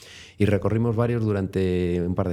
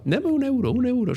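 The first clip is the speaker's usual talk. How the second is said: much higher insistent squeaky demand; the lady demanding. Retold or imitated. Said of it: "la otra señora estaba". (señora exigiendo un euro con voz muy aguda)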